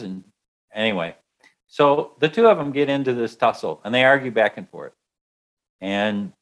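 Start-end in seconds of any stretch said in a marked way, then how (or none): none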